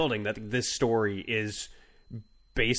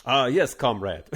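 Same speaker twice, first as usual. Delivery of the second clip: louder, amused Russian guard; bad Russian accent; 6 dB louder than usual. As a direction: wave someone through in mock Russian accent. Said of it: "That's my terrible Russian accent".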